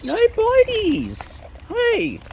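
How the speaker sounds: sharp high scold to stop the biting. Real, not acted. Scolding a bird that bites.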